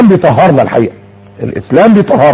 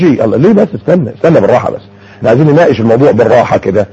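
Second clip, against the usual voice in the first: usual speaking voice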